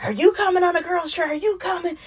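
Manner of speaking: put-on high, quick, nosy voice going girl to girl; high fast probing voice. Contessa asking each girl about the trip, high and quick. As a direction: pitch it way up and rattle off the question. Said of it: "Contessa goes around checking the temperature".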